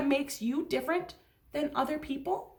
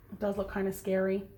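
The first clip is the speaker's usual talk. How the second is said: ordinary delivery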